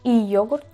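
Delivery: "yogurt" with a separate i in front, i-ó-gurt; i separada. Shown as a demonstration. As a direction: split "yogurt" into i plus ogurt. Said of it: "yo no digo". ("yogurt" con una i suelta delante)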